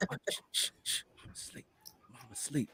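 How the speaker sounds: urgent shushing in a voiceless whisper; imitated whisper. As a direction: whisper like a kid trying not to wake mama. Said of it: "he's whispering".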